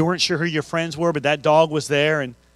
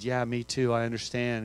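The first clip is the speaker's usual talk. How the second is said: softer, lower, tender; gentle comfort; 6 semitones lower, 9 dB quieter than usual. Retold or imitated. Student comforting a classmate, soft and tender. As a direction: soothe a grieving friend quietly. Kid comforting grieving girl.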